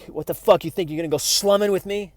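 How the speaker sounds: imitating an angry outburst, high and loud; angry Matt Damon quote. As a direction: hurl it at her like a wounded man lashing out. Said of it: "And he's like".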